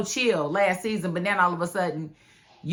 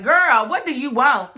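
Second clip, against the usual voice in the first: put-on louder, higher, laughing: Girl, what do you want; loud mock brush-off; 5 semitones higher, 8 dB louder than usual. [name] picks up Lily's call, loud and laughing. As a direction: fling it out with a laugh, fed up.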